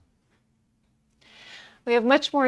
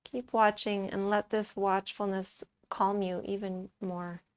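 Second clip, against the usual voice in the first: soft, calm meditation voice; hushed, even yoga-teacher delivery, settling softer; guided meditation; 2 semitones lower, 9 dB quieter than usual. Acted. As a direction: guide them quietly into watching the breath, with long pauses. Leads a breath meditation.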